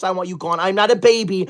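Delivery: ordinary talk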